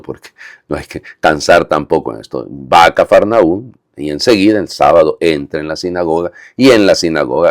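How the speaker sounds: ordinary talk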